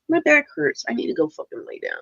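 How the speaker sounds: ordinary delivery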